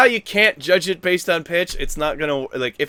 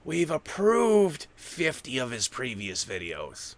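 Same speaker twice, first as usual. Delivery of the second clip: algorithm voice. Retold or imitated. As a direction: murmur it like a conspiring computer. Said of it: "the YouTube algorithm goes".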